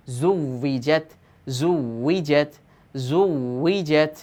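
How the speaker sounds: জুউইজাত drawn out very slowly, each syllable held; very slow drill. Shown as a demonstration. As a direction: spell out জুউইজাত slowly like a tajweed teacher. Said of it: "তাজদিদযুক্ত শব্দের অনুশীলন".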